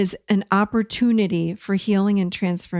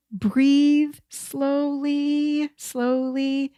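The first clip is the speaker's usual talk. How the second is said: drawn-out words, higher and held level; slow, calming; 5 semitones higher, much slower than usual. Shown as a demonstration. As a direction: stretch each word like a long slow breath. Guiding listeners to breathe slowly.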